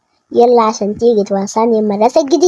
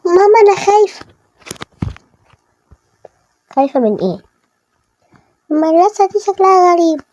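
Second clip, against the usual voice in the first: higher, small frightened voice; scared; 6 semitones higher than usual. Real, not acted. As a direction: tell mama you're scared. Child scared of the new school. (طفلة خايفة بصوت عالٍ)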